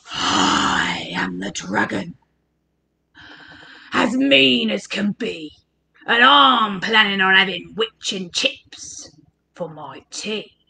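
very low, growly dragon voice; storybook dragon, deep and threatening; menacing dragon. A narrator's reading. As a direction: rumble softly with mean relish.

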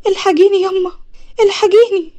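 high, loud, tearful call to mother; acted desperate, sobbing plea; crying for help. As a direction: wail for help in panic.

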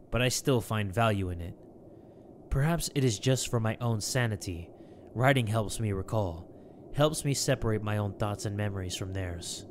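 low, soft, measured reading; journal narration. Acted. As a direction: read it as a reflective diary voice-over. Reads journal.

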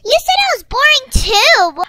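loud, hurt accusation; angry accusation. Acted. Friend accuses Maddie in their fight. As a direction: hit her with it.

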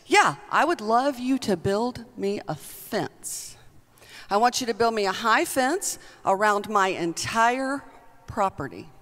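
normal talking voice

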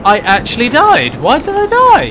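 loud disbelief climbing into a squeal; real high-pitched indignation; indignant shouting. Real, not acted. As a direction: protest loudly, pitch shooting up. Protesting own death in game.

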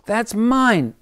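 high, loud squeaky squirrel. Acted. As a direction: squeak it out in protest. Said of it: "squeaked the squirrel".

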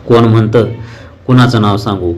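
plain reading voice

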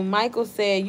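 ordinary talk

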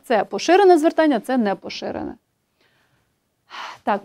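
ordinary delivery

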